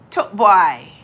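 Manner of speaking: ordinary talk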